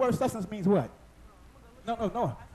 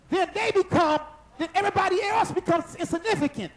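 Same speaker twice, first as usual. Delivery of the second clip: fiery preaching; 6 semitones higher than usual. Acted. Pastor firing up the congregation, a fiery preach. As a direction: preach it hard to the church.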